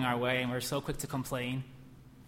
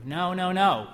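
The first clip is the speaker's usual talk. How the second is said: higher, louder no no no; loud repeated no; 6 semitones higher, 8 dB louder, slower than usual. Acted. Preacher refusing the devil's lie with force. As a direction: shut it down emphatically.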